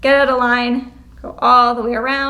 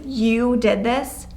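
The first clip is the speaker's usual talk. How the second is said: slow, pointed blame; accusatory; much slower than usual. Shown as a demonstration. Teacher showing students an accusing tone. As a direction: point the finger with it. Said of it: "it sounds accusatory".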